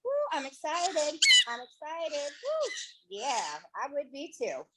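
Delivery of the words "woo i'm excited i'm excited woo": high, gleeful bird voice; voicing the bird. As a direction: speak for the excited bird. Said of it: "That's why he's like".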